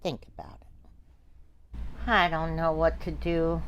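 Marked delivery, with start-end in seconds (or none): 0.0-0.7 whisper. Acted. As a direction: breathe it, no voice at all. Repeats the line as a whisper.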